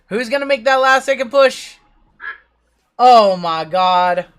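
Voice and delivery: slow, high, loud race finish call; drawing out the winner, loud and high; excited race call. Real, not acted. Calling the duck race finish.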